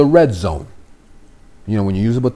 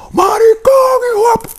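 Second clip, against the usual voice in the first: screaming far higher and louder, voice cracking; all-out enraged scream at the captive in Spanish; furious screaming; 5 dB louder than usual. Acted. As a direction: explode with rage, spit flying. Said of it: "when they get mad, they get fucking mad".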